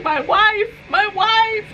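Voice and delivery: high, worried plea, imitating him; mock-anxious husband voice. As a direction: repeat "my wife" like a fretting husband. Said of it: "Alex was so worried".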